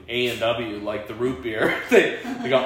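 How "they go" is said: ordinary delivery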